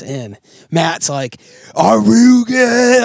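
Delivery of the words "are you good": high, loud, drawn-out panicked yell; slow, strained scream of a sick kid on a simulator; retold scream. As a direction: yell it like a kid about to puke on a ride. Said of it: "Like, screaming".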